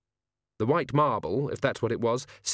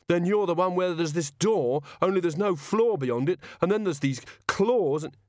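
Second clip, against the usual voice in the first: high, anxious guessing; frightened guard; 7 semitones higher than usual. Acted. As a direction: blurt out another nightmare, voice pitched up.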